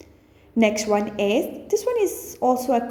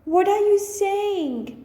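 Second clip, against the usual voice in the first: much higher in disbelief; incredulous; 8 semitones higher than usual. Shown as a demonstration. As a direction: ask it like you can't believe your ears. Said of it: "One is".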